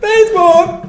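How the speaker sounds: very high squeaky shout of the word; squeaky exclamation. Pretend. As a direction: squeal it out playfully. Mock announcer exclamation.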